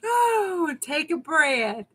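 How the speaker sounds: high, slow teasing coo; high, slow mock soothing. Real, not acted. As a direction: coax her to calm down, playfully. Teasing a laughing viewer.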